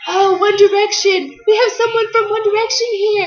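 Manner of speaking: excitable mock radio host; radio announcer. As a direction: announce a big guest, hammed-up and excited. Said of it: "the radio announcer was like".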